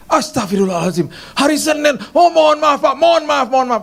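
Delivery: a maid caught out, apologising in a fluster; panicked maid. Retold or imitated. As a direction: play the maid suddenly panicking. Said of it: "dia yang panik sendiri".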